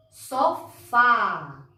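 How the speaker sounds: "Sofá" syllable by syllable, long open fá; stressed open syllable. Shown as a demonstration. Teacher confirms the open sound, stressing "fá" in "Sofá". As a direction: land on the "fá" of "Sofá" and hold it. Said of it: "som aberto no fá".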